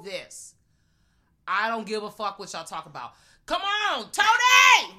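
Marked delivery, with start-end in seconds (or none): 3.5-4.9 loud, high yell at the screen. Retold or imitated. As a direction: holler at the TV for him to act. That's me at the T V.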